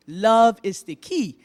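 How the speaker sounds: lightly voiced quote of a local figure; quoting Cozy Fulford. Speaker closing the speech with a quote, lightly voiced. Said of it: "as Cozy Fulford from Harper would say".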